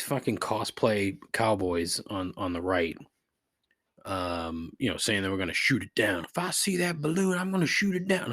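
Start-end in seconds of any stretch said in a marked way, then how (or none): 6.2-8.3 put-on Southern accent. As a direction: imitate a cosplay cowboy with a Southern accent. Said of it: "Of course they have a Southern accent".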